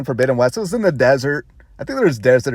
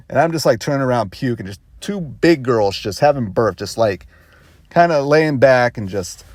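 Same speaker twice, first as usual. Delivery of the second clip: usual speaking voice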